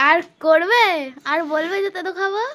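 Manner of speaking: high, loud gloating tease; teasing. Real, not acted. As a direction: gloat at mom in a singsong. Teasing mom after prank.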